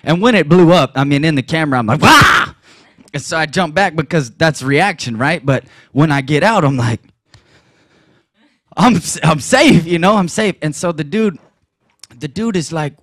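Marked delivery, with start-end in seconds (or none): 2.0-2.5 sudden high yelp of shock; startled yelp. Retold or imitated. Preacher reliving the blast, yelping in shock. 8.8-10.6 stunned relief. Retold or imitated. Shaken after explosion, loud.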